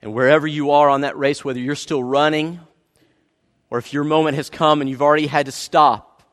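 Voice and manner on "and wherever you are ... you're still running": ordinary talk, nothing marked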